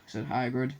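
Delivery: plain reading voice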